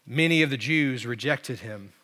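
normal talking voice

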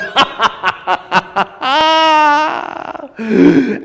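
gloating villain laugh; evil laugh. A narrator's reading. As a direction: cackle with triumph. Villain laughs after speech.

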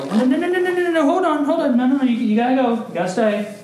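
high, hurried fatherly protest; dad blocking twins from following, high and flustered; frantic no no no. Retold or imitated. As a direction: stop the toddlers at the door, flustered. Dad stopping twins following him.

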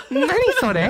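high-pitched imitation of a puzzled woman; high, retold Japanese question; quoted Japanese woman. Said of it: "She's like".

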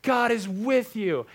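imitating a preacher's slow yell; mock yell. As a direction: belt it out slowly like a preacher. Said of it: "yelling, he's like".

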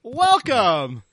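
a hint of a grand tour guide. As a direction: welcome them like a showman guide. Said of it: "the tour guide comes out and he's like".

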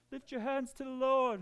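high-pitched mock-Australian worship leader; Australian accent. Preacher mocks worship leaders urging the congregation, Australian accent. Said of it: "they'll get the Australian accent".

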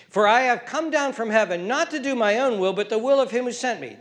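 loud, high sermon cry; full-force preaching. Real, not acted. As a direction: preach it to the back row.

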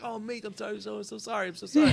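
high, soft mock-Australian apology; imitated Australian accent. Actor apologizing over and over, imitated Australian accent. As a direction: repeat the apology in light put-on Australian. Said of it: "'cause he's Australian".

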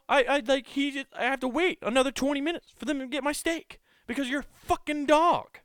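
annoyed friend rant. Retold or imitated. Hungry friend complaining to Larry about his dog, rising whiny rant.

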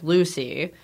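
ordinary talk

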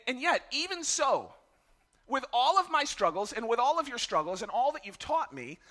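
usual speaking voice

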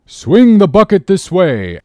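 high, loud, slow command; a sailor hollering orders in a crisis; urgent shouted order. Acted. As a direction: call it out over the chaos. Sailor shouts order during rescue.

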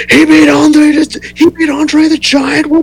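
announcer's excited call, imitated; Jim Ross call. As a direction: shout the call like a frenzied announcer. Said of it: "you hear Jim Ross goes".

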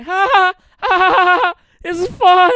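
very high, loud imitation glee; mock giddy shrieking. Characters running in circles, hammed-up giddy yelling. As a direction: whoop it out like overexcited kids.

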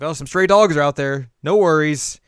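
high, loud, airy brush-off; dazed Moroku voice. Retold or imitated. Moroku under the spell reassuring the lady, high and loud. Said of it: "He's like".

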